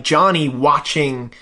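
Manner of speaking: ordinary talk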